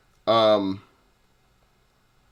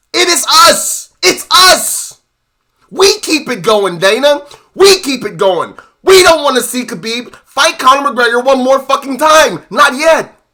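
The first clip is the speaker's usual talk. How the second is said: louder and higher, shouting at Dana; angry shout; 5 semitones higher, 6 dB louder than usual. Real, not acted. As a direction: bellow it for the fans. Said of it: "I'm really mad".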